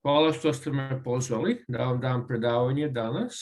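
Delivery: usual speaking voice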